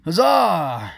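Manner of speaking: high, loud storybook huzzah; hearty cheer. Acted. A crowd praising their queen, hearty high cheer. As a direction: cry it out as a rousing cheer.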